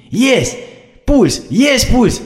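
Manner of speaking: high loud joyous shout; tearful joyful cry. A narrator's reading. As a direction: cry out in relief. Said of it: "со слезами на глазах закричала Яна".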